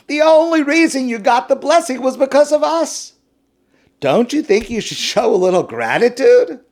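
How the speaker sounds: high, loud, brazen insisting; entitled demand. Acted. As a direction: demand thanks with full chutzpah. Ammon and Moab demand gratitude.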